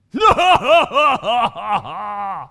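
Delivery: big, loud mocking belly laugh; hammed-up villainous laughter; taunting giant laugh. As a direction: boom out a long giant's laugh, falling away. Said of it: "this idea of the taunting".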